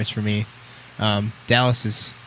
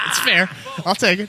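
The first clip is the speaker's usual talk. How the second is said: laughing; 10 semitones higher than usual. Real, not acted. Laughs after being mocked.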